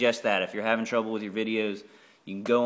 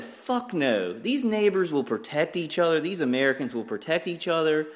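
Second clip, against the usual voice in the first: defiant refusal; 8 semitones higher than usual. Retold or imitated. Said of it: "I would have said".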